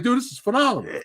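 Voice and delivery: high-pitched, amazed reaction; retelling an awestruck reaction; thrilled exclamation. Retold or imitated.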